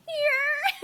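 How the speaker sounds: high, tiny squeak of a word; retold near-tears nervousness. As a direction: imitate a starstruck fan barely getting it out. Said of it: "trying not to cry".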